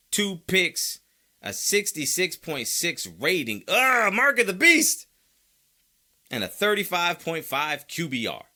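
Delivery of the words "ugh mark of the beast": high, loud mock-horrified cry; mock horror. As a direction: groan into a hammed-up outburst at an evil omen.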